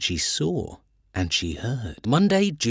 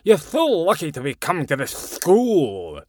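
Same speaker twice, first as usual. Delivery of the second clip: put-on old headmaster voice; 4 semitones higher, 4 dB louder than usual. A narrator's reading. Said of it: "He said".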